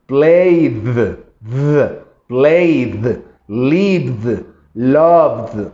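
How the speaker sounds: played, lived, loved with a drawn-out final D; slow, overdone played with a heavy D; exaggerated -ed, said slowly; exaggerated D ending. Shown as a demonstration. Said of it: "voy a exagerarlo".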